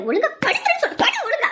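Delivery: warning that leaps to a high, loud order; angry command. Acted. Woman ordering the child to lie down, angry command. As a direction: build from a stern warning to a shrieked order.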